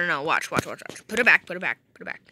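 ordinary talk, nothing marked

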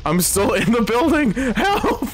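mock panic. Stuck in burning building.

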